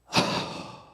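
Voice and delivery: acted exasperated sigh; heavy sigh. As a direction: let out a long, breathy sigh. Acting out bad day at home.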